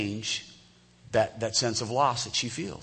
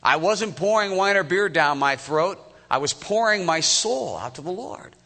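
lightly voiced, higher, earnest protest; a hint of a wronged woman defending herself; mildly acted earnest answer; Hannah's earnest reply; 7 semitones higher, 4 dB louder than usual. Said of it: "I love her answer".

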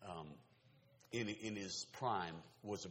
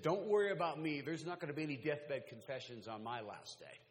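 loud, higher defiant retort; defiant quote; 7 semitones higher, 8 dB louder than usual. Retold or imitated. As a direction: throw the atheist's boast out. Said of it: "Chris Hitchens ... said".

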